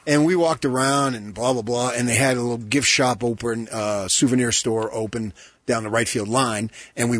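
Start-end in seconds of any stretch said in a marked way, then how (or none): none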